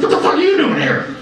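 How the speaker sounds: high-pitched baffled outburst; mock astonishment. Soul spotting Santa at heaven's gates, astonished. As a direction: blurt it out in comic disbelief.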